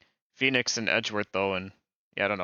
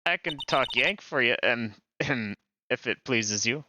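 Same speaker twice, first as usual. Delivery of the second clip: put-on Southern accent. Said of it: "I'm not the best at doing accents".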